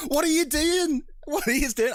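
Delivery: retold high-pitched exasperated protest; exasperated outburst. Film viewer protesting at the actors' Irish accents, exasperated.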